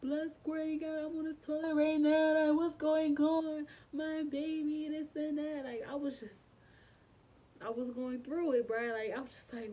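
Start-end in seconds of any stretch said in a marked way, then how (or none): 0.0-5.6 high, loud distressed call to mom; pitched-up, urgent plea; retold crying panic. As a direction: reenact wailing for help without real sobs. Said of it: "bust out crying".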